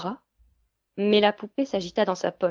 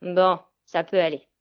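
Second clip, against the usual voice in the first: grudging grunt; 5 dB louder than usual. A narrator's reading. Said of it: "Elle grogna".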